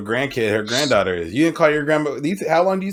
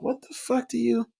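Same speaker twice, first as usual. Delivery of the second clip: incredulous; 11 semitones higher than usual. Retold or imitated. Incredulous at girlfriend naming players.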